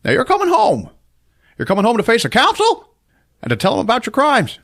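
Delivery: high, strained mock-dramatic order; mock stern command. King ordering Clarence home to face the council, high mock-stern command. Acted movie confrontation.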